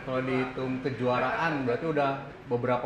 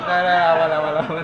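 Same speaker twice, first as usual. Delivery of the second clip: laughing; 3 semitones higher, 11 dB louder than usual. Real, not acted. Laughing off a spill.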